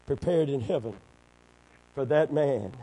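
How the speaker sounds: ordinary talk, nothing marked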